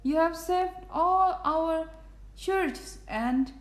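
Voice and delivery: high little voice; tiny speck's voice. A narrator's reading. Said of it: "came the voice".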